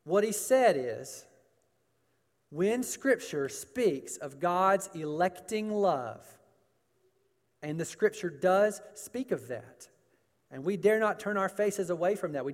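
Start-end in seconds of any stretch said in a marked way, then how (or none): none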